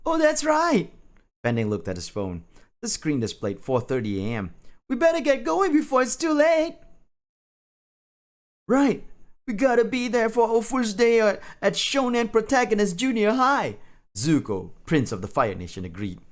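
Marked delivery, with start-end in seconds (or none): 0.1-0.9 high-pitched realisation; high cartoon character voice; anime schoolkid. Pending's line in put-on voice. 4.9-13.8 high-pitched eager urgency; high cartoonish chatter; anime schoolkid. Schoolkid characters in put-on voices.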